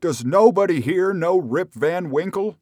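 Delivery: high, loud cry of despair. A narrator's reading. Rip begs the crowd to know him, despairing cry. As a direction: cry out as if lost. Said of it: "cried out in despair".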